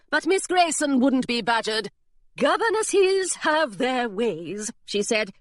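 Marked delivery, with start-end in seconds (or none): 2.4-4.7 slow, lofty governess; prim governess. Acted. Governess brushing off Hilary's question, slow and prim. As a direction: savour each word, a little smug.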